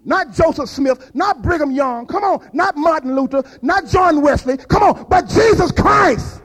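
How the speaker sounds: high, loud preacher's build-up; full-force preaching. Acted. Preacher rousing the congregation, loud and high. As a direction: climb up hard as the sermon takes off.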